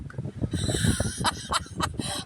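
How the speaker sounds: very high, breathy cackle; cracking up. Real, not acted. Storyteller picturing her mouth agape, breathless high cackle.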